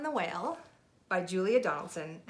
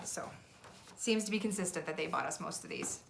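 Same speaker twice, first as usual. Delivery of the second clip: usual speaking voice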